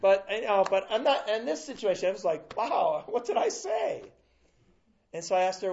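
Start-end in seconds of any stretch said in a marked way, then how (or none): 2.6-4.0 surprised. Retold or imitated. Surprised professor at crying student.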